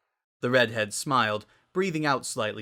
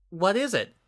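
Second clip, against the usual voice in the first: higher, uneasy ask; worried question; 9 semitones higher, 3 dB louder than usual. A narrator's reading. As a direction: sense something is wrong. Sunset worried.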